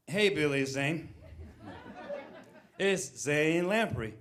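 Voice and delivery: slow, drawn-out tipsy greeting; drunk caller. Retold or imitated. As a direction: stretch every word like a sloshed well-wisher. Re-enacting his drunken New Year's message.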